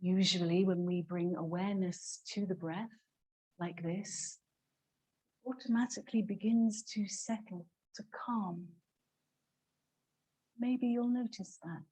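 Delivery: soft, hushed meditation voice with long pauses; guided meditation. Real, not acted. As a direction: guide them quietly into watching the breath. Leads a breathing meditation.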